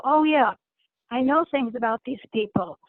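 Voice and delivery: usual speaking voice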